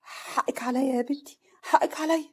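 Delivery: slow, rising apology; remorseful. Acted. Mother-in-law apologizing to daughter-in-law, remorseful. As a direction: plead for forgiveness. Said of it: "صفيه بندم".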